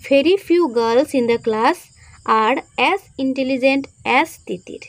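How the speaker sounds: slow, measured phrase-by-phrase dictation. Shown as a demonstration. Teacher giving students the answer to write in notebooks, slow dictation. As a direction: dictate it slowly, pausing between phrases.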